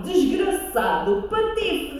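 high, slow outraged insult; indignant dragon. Acted. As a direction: spit out the insult. Dragon scolds prince. (dragão indignado)

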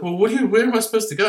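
high, fast mock whine of the kicked-out boyfriend; mock whiny boyfriend.

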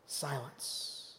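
whispered, low command; hushed command. Retold or imitated. Jesus calming the storm, a hushed whispered command. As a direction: breathe the word silence out, hushed. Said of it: "walks out over the water and says".

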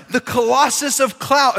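high, grand mock proclamation; acted hero worship. Movie kid hailing a baseball legend, mock grandly. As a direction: proclaim the nickname with hammed-up awe.

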